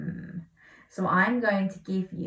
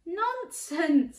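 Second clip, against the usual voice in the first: giant's wife; 10 semitones higher than usual. A narrator's reading. Giant's wife dismisses him.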